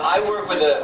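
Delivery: normal talking voice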